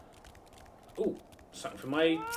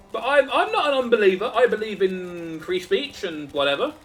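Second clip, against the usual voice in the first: mock protest; 7 semitones higher, 5 dB louder than usual. Mock protest to game character.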